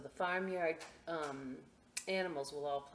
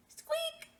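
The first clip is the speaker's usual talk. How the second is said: mouse squeak. Acted. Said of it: "said Mouse".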